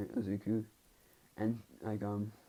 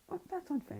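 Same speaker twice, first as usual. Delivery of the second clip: high squeaky complaint; whiny girl; 5 dB quieter than usual. Retold or imitated. A girl protests that the win was unfair, in a high whine.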